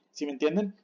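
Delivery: ordinary talk, nothing marked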